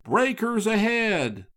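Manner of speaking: a lightly voiced lookout crying out; warning cry. A narrator's reading. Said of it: "Another cry came down the wind".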